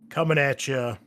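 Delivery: announcer hype opening; podcast host announcer voice; show intro. Acted.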